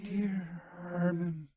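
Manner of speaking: soft, slow sigh of a name; a ghostly, hushed sigh; sighed words. A narrator's reading. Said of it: "there came like a sigh the words".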